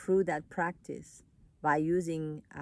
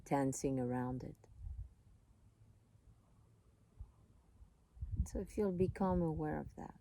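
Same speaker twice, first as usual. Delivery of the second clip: calm, unhurried voice with long pauses; soothing meditation guide, leaving space between phrases; guided meditation; 5 dB quieter than usual. Real, not acted.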